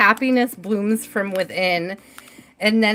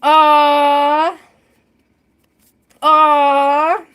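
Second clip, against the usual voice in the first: long, loud, higher "awww"; sustained cooing "awww"; delighted "aww"; 5 semitones higher, 6 dB louder than usual. Real, not acted. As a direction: let out a big drawn-out "aww". Touched, sighing after laughing fit.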